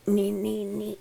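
high whispered nee; protest. Acted. Sick old woman refusing nursing care with high whispers.

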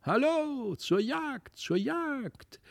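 merry high huntsmen's cry; hunters calling. A narrator's reading. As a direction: call out like tiny huntsmen.

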